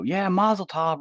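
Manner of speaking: snide mazel tov. Retold or imitated. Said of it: "he's gonna go".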